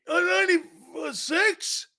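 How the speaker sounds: put-on high, slow, unsure old-timer; toothless Cowboy fan. Corrected Cowboy guy guesses another year, high toothless voice. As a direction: grope for the year in a high, gummy voice. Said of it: "put your false teeth in".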